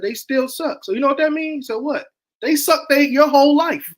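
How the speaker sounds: high, loud, hammered punchline; emphatic retold ribbing. Uncle retelling how he set his nephew straight, emphatic.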